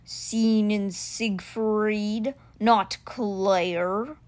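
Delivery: very slow, stretched big cat question; stone cheetah, puzzled. Acted. Stone cheetah asks the boy about missing friend, slow voice. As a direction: draw out the names ponderously.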